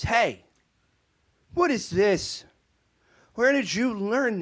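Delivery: loud, high, slow accusing demand; hammed-up angry father demanding answers; stern dad confrontation. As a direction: act the outraged PSA dad, drawing out the questions. Irate dad in retold ad.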